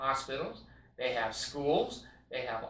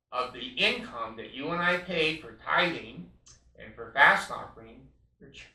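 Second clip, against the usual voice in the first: ordinary talk, nothing marked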